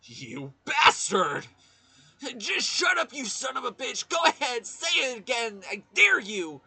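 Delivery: high, loud screaming rage; furious yelling. Acted. Mondo yelling at the mastermind in a furious rage. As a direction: scream the insults at him, daring him.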